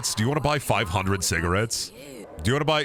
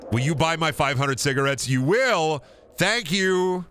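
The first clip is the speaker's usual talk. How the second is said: pleading then delighted; 6 semitones higher than usual. Real, not acted. Begging trader to buy cigarettes.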